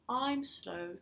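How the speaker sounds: usual speaking voice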